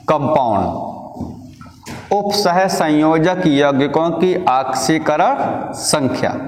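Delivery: words drawn out slowly for students to write down; dictating a heading word by word; slow dictation. Acted. Teacher dictating a notes heading to students, very slow.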